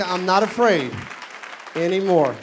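loud, emphatic declaration for a congregation to repeat; bold declaration. Shown as a demonstration. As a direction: proclaim it loudly for the room to echo.

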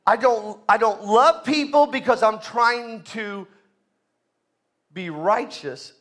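loud, rising sermon delivery; full-force preaching. Acted. Pastor urging the church to grow, preaching loud. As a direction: drive it home to the congregation.

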